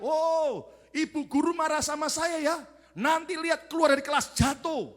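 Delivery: put-on high-pitched child's defiant cry; yelled threat. Boy cursing the teacher who scolded him, yelling. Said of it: "langsung dia teriak".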